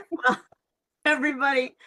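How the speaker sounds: high, giggly laughing. Real, not acted. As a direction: laugh through the words. Host laughing after child voice.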